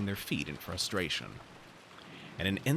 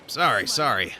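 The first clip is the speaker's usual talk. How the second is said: louder, repeated apology; pleading apology; 2 semitones higher, 7 dB louder than usual. Acted. Character apologizing before following someone out, pleading and louder. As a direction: apologize insistently, as though praying. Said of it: "After apologizing several times as though praying".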